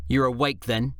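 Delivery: lightly reproachful wife. Acted. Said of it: "Faye's reproachful tone".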